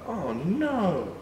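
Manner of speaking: usual speaking voice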